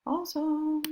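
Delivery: excited awesome. Real, not acted. Praising Madison.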